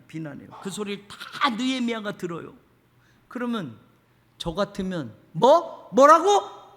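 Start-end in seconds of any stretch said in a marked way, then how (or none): 5.3-6.7 loud, high indignant retort; mock outburst of temper; flaring up. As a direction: snap back as if insulted. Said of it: "그럼 우리도 욱하잖아요".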